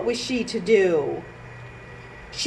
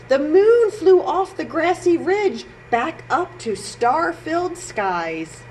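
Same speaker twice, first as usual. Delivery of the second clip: plain reading voice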